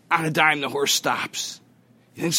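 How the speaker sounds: ordinary delivery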